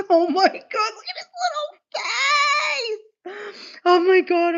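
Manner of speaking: high squeal that drops to a teary hush on little face; cooing squeal. Real, not acted. Streamer cooing at the quokka's face, squealy then teary. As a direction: melt over his little face.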